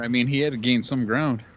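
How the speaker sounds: usual speaking voice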